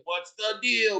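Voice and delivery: light, high retold scream; imitated screaming. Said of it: "didn't know why he's screaming".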